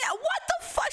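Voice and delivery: high incredulous shout; furious high-pitched what the fuck; outraged yell. Real, not acted. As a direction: explode in disbelief at your friend. Arguing about the girl's accent.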